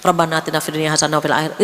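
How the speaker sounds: rattled off fast and low, distracted; hurried throwaway recitation; rushed careless prayer. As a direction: gabble it like a chore. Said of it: "ala kadarnya saja doa kita".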